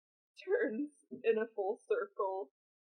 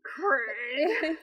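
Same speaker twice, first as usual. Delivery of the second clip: malicious gritted-teeth call; 4 semitones higher, 6 dB louder than usual. Shown as a demonstration. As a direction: spit the name through gritted teeth. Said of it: "gritted teeth and a bit of malice".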